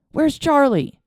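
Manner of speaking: urgent shout. A narrator's reading. Father demands in panic.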